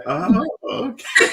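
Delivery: high, drawn-out imitation of an "oh"; mock reaction. Host playfully imitates guest's reaction to his team, mock reaction. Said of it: "He said".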